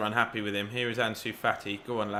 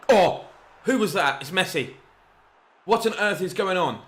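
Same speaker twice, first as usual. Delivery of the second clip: higher, louder shocked outburst; surprised; 6 semitones higher, 5 dB louder than usual. Real, not acted. Gamer shocked by Messi's goal, surprised. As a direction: caught off guard by a wonder goal.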